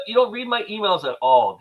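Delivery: high-pitched exasperated complaint; indignant counter-accusation. Real, not acted. Co-host blames friend for ignoring emails, indignant counter-accusation.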